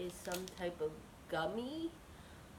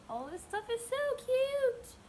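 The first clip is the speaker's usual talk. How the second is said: excited squeal; 5 dB louder than usual. Real, not acted. Gushing over cute unboxed items.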